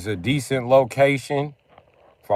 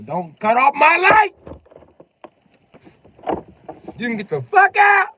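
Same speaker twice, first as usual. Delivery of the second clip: high, loud panicked screech; grandma yelling; 5 dB louder than usual. Retold or imitated. Grandma yelling at the family during a storm, high loud screech. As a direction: shriek like a terrified grandma.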